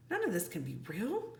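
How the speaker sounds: disbelieving. Acted. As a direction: say it quietly to yourself. Jack denying reality.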